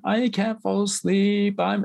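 put-on high, slow whiny child voice; imitating crying child. Daughter coming upstairs to her parents, mock whiny and tearful. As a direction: mimic a sleepless little girl near tears. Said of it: "and she's crying".

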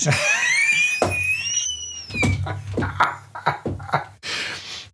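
long high whooping laugh. Real, not acted. Big laugh after joke.

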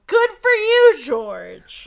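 high, loud gleeful cheer; gleeful yell. Acted. Bess cheering George's win, gleeful loud yell. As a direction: yell it in delight. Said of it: "Bess gleefully yells".

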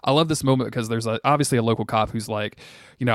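ordinary talk

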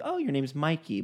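a touch of mock discovery; mild surprise. New friends learning his old nickname, mildly surprised.

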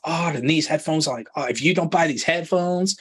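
hammy fake American ad read; bad American accent. Host mocking a podcast ad in a bad American accent. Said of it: "that's my really bad American accent".

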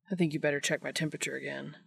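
low, soft, fast come-on; seductive Dean. A narrator's reading. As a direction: coax him to check your temperature. Dean flirting, voiced in story.

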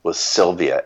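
ordinary talk, nothing marked

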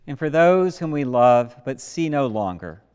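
loud, ringing prayer of commendation; solemn prayer. Acted. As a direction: intone it to the whole church.

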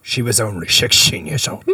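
a lightly done Michael Caine, soft and low-key; a hint of Cockney Michael Caine, understated; Michael Caine impression. Suggesting a British accent, lightly voiced Michael Caine. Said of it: "That's, that's Michael Caine".

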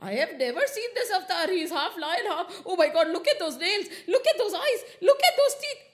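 high, rushed, mounting panic; alarmed goddess. A narrator's reading. Lakshmi recoils from the half-lion avatar, panicky.

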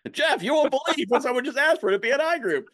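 high and loud, bursting to tell; excited news. Pretend. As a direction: blurt it out to Jeff, thrilled. Excited call to Jeff.

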